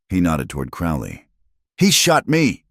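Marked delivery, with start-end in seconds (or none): 1.8-2.6 high loud shriek. A narrator's reading. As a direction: shriek it in outrage. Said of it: "Crowley shrieked".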